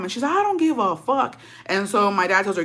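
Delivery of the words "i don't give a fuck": defiant retort. Retold or imitated. Mom dismissing threat in quarrel.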